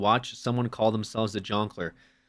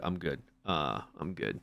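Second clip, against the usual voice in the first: low, very soft polite refusal; night-store small talk; 8 semitones lower, 11 dB quieter than usual. Retold or imitated. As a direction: lightly act a subdued late-night shopper. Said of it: "when I go to the store at night".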